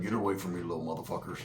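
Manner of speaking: low, very quiet grumble; low muttered brush-off to the kids; grumpy Roach. Retold or imitated.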